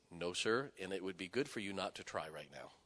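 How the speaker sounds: low, soft, firmly controlled; quiet warning. Retold or imitated. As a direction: warn him politely under your breath. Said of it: "I said it just like that".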